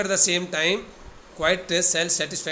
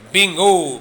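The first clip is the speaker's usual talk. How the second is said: loud, triumphant wizard cry; triumphant shout; 3 semitones higher, 10 dB louder than usual. Retold or imitated. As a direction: call it out in triumph. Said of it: "in the voice of the elderly wizard".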